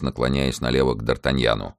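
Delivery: ordinary delivery, nothing marked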